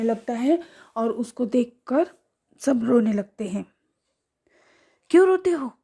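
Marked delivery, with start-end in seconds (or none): 5.1-5.7 higher, louder scolding shout; sharp raised shout at the children. A narrator's reading. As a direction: yell it at the crying kids. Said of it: "चिल्लाकर रात रानी बोलती है".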